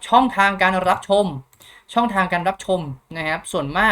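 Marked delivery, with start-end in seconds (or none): 0.1-1.3 louder, higher topic header with a rising end; announcing a heading. Shown as a demonstration. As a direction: call out the section title. (ประกาศหัวข้อเสียงดังขึ้น)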